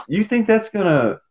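high-pitched retold disbelief; mock incredulity. Questioning whether heckling works on batters, mock incredulous.

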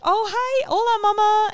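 high, bright sing-song greeting; sweet teacher voice. Retold or imitated. Mom retelling the teacher's high sing-song hello. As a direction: greet like a cheerful kids' teacher. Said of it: "she was like".

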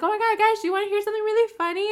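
high, fast eager gush; high rushed excitement; excited gush. Retold or imitated. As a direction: burst out with juicy news. Excited retelling own words.

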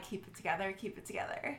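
very soft, sinking into a whisper; whispered pep talk to herself, acted out; under-breath self-talk. Fan steadying herself after he called her darling, hushed whisper.

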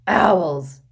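loud angry bellow. Acted. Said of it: "bellowed Uncle Vernon".

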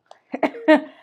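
short, loud burst of laughter; real laugh, high and loud; amused laugh. Real, not acted. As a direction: crack up at your own remark. Laughs at own remark.

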